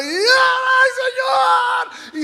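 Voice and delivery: high, loud falsetto scream for help; panicked falling cry. Acted. Hombre cae al precipicio gritando. (grito agudo de pánico)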